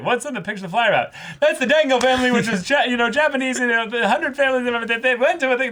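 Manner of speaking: put-on high, loud, fast gushing girl voice; excited anime girl. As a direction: gush like an overeager anime girl. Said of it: "transition from there is like".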